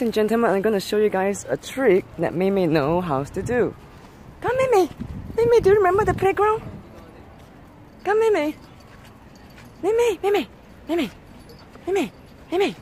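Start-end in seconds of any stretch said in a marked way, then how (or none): 4.4-12.8 high, loud coaxing call; coaxing the dog. Real, not acted. Owner coaxing her scared dog onto the slide, high and loud. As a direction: sing her name up high to coax her.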